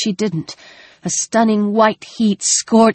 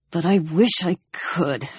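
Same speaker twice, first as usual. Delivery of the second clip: miserable; 5 dB quieter than usual. Acted. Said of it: "She heard his misery".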